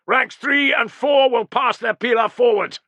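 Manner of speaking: high, loud battlefield order; shouted command. Acted. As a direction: carry the order to the rear ranks.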